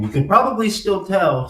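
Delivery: speaking after dental surgery; mouth after dental surgery. Real, not acted. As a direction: talk through a sore mouth. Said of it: "I'm speaking a little bit funny".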